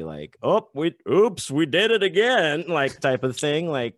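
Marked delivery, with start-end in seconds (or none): 0.4-2.6 high, loud hammed-up oops; mock-innocent admission, loud and high. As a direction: play a lazy sequel pitch, pitched up. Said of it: "starts really lazily".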